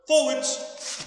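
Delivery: high loud called direction; shouted command. Real, not acted. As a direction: call it out to the class. Coach calling out directions.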